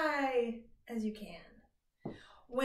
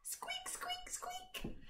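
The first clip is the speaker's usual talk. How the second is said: high, soft squeaky mouse; mouse squeak; 13 dB quieter, slower than usual. Pretend. Teacher playing a mouse for the kids, high soft squeaks.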